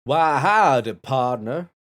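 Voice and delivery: put-on Texan accent. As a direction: belt it like a Texas cowboy. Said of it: "your best Texan accent".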